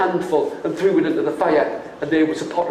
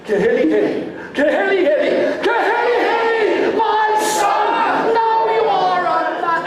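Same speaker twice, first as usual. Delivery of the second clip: building joyful cry; 14 semitones higher than usual. Shown as a demonstration. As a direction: swell from a murmur to a joyful call. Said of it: "Can you do that with me".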